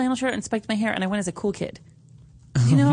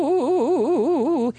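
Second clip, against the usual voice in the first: ghostly echo; 7 semitones higher than usual. Acted.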